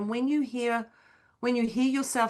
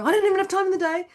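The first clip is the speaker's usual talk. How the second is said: high, loud, rushed imitation of complaining; mock fluster; 11 semitones higher, 5 dB louder, much faster than usual. Coach mimics an overwhelmed client's complaint, high and rushed. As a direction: squeal out the complaint fast, hammed-up. Mimics someone panicking about time.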